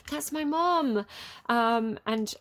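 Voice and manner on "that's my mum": higher, slow, delighted "That's my mum"; delighted recognition. Retold or imitated. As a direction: lift "mum" up in happy surprise. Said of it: "I was like".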